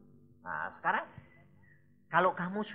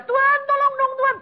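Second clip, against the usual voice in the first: much higher, wheedling cry to God; pleading new convert; 10 dB louder than usual. Retold or imitated. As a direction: beg God like a needy new believer. (meniru orang baru bertobat merengek pada Tuhan, suara tinggi)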